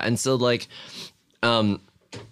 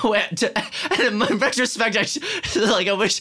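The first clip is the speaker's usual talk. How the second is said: laughing; 12 semitones higher than usual. Real, not acted.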